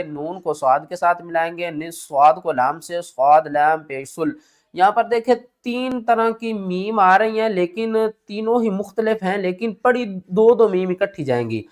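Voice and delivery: usual speaking voice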